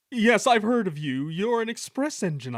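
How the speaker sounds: high, loud self-important voice; lofty, showy greeting; haughty engine. A narrator's reading. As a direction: talk grandly to a rival. Conceited engine greets Gordon.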